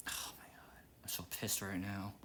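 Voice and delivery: hushed, furious whisper under the breath; angry whisper. Real, not acted. Venting alone about the annoying salesman, whispering furiously. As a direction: seethe it quietly, barely voiced.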